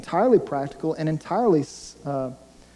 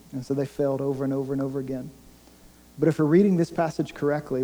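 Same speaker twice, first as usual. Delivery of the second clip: ordinary talk, nothing marked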